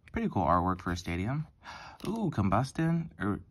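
usual speaking voice

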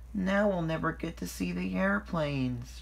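high, plaintive lament; disappointed sigh. A narrator's reading. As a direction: sigh over a missed treat. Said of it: "sighed Percy".

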